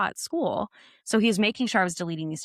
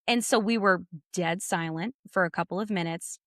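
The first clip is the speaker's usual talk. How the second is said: usual speaking voice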